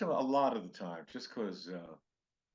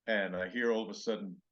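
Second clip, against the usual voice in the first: ordinary talk, nothing marked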